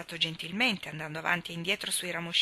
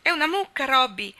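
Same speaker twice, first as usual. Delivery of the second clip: relieved, laughing; 6 semitones higher, 8 dB louder than usual. A narrator's reading. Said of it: "disse ridendo".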